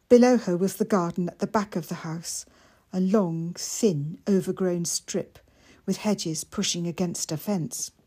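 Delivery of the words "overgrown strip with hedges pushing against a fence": ordinary delivery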